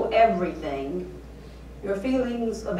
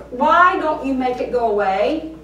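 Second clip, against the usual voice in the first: louder, fierce cry to God; fierce angry cry; 4 semitones higher, 9 dB louder than usual. Acted. Preacher crying out to God in fierce anger, loud. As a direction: hurl the lament at heaven. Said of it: "with the fierce, angry tone".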